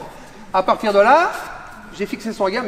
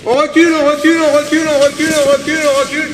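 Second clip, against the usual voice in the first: on recule barked loud and high, over and over; shouted drill; 5 semitones higher, 9 dB louder than usual. Real, not acted. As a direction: drive the class back with shouted commands. Coach shouting drill to class.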